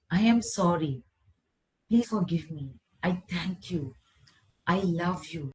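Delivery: soft, tender forgiveness phrases; gentle. Shown as a demonstration. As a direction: say it gently, softening as you go. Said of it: "Just tell gently to it".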